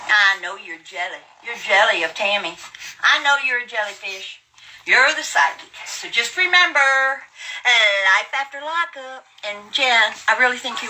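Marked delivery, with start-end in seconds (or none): none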